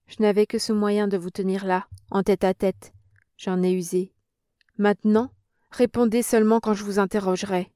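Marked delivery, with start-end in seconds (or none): none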